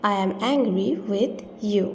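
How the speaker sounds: drawn out, word by word; very slow repeat. Shown as a demonstration. Teacher repeating the sentence very slowly. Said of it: "প্রথমেই রয়েছে".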